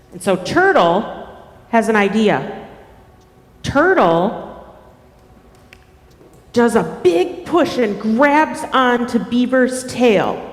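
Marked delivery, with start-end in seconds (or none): none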